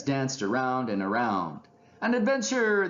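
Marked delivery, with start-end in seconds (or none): 2.0-2.9 very high, contained cheer; excited shout. A narrator's reading. Two friends dancing around together, excited cheer. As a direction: cheer it high without much volume. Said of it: "they both shouted".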